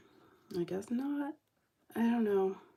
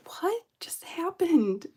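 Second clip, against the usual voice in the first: stunned whisper; 8 semitones higher, slower than usual. Retold or imitated. Stunned after employee walks off.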